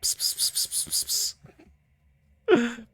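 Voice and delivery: string of voiceless cat-calling pssts; rapid whispered psst sounds; imitated psst-psst. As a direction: summon a ghost like a cat. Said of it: "rubbing his fingers going together".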